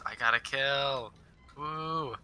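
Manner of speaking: normal talking voice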